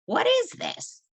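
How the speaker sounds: put-on high, curious little girl voice; a small girl asking in wonder; little girl's question. Little girl finds the display.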